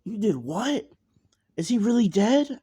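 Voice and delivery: high, loud shocked outburst; stunned disbelief. Acted. Nami stunned by the news.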